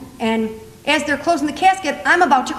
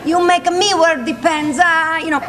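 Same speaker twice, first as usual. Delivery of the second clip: put-on mother's Italian accent; 3 semitones higher than usual. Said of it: "My mother was all upset".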